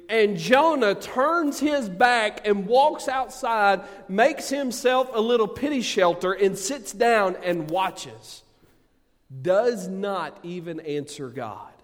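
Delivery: loud, high, driving sermon voice; full-force preaching. Acted. Pastor rousing the congregation, loud full-force preaching. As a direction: preach it hard to the whole room.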